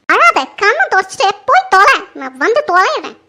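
loud, scolding order; exasperated, forceful snapping; irritated dismissal. Acted. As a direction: send her off impatiently. Irritated order to the crying maid.

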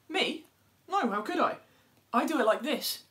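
high, loud, prim denial; a smug little bird protesting her innocence; innocent animal voice. Acted. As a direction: play the dove, shocked at the idea. Dove denies it, shows how.

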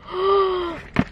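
high, drawn-out gasp; delighted gasp. Real, not acted. Vlogger spots the gift she wanted, delighted gasp.

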